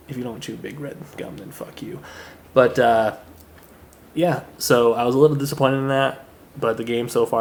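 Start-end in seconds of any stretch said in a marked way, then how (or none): 0.1-2.0 whispered aside. Real, not acted. Cheeky aside to viewers.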